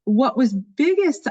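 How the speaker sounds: ordinary talk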